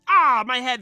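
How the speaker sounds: loud, high cry of pain. Acted. Red and Green hit by Psy Wave, pained cry. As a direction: cry out as the psychic wave hits. They're all screaming and yelling.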